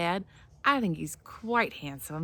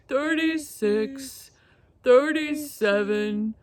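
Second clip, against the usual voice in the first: slow, drawn-out vulture counting; Mister Vulture voice; 4 semitones higher, 4 dB louder, much slower than usual. Shown as a demonstration. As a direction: stretch each number like a lazy vulture. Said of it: "And they kinda talk like this".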